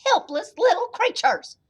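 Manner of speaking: high, loud scolding mama; high-pitched storybook telling-off; yelling old woman. A narrator's reading. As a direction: scold your big son as a tiny old lady. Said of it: "she yelled".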